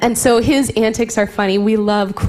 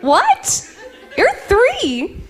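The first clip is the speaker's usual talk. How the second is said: disbelief; 13 semitones higher, much slower than usual. Real, not acted. Mock disbelief at a toddler.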